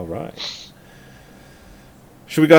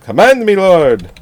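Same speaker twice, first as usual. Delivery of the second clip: loud, high hammy devotion; mock servant; 7 semitones higher, 5 dB louder, slower than usual. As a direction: proclaim it like a loyal servant.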